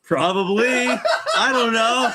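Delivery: sheepish giggling answer; laughing. Real, not acted. Laughing at own mix-up.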